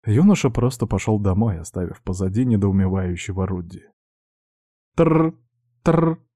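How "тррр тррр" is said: high mock phone ring, trilled; phone ring imitation. Narrator imitating a phone ringing with a high trill. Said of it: "зазвонил его заблокированный мобильник".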